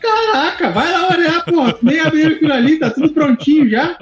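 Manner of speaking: exasperated, fast and high. Retold or imitated. Retells his own exasperated reply. (imitando a própria irritação)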